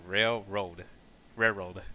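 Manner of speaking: "Railroad" blurred towards "rare-rode"; struggled pronunciation. Shown as a demonstration. Streamer retrying a hard word, struggling. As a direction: attempt "Railroad" twice, losing the l. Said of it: "I struggle to say that word".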